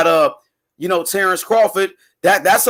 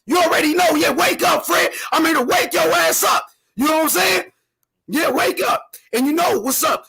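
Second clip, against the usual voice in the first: hype shout; 5 semitones higher than usual. Real, not acted. Caller hyping, calling Fred out.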